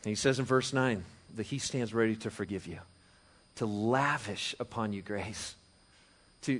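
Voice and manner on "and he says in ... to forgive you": usual speaking voice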